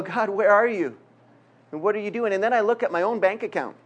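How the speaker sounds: ordinary delivery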